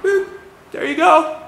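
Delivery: high, chirpy mock clerk voice; cheery clerk. Clerk handing back the sheet, high breezy mock cheer. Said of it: "were like".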